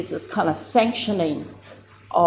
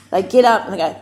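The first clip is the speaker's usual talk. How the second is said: normal talking voice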